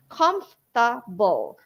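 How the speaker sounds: Comfortable in three syllables, kumf-tuh-bul; correct comfortable. Shown as a demonstration. Modeling for teachers, three-syllable Comfortable. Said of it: "just three syllables".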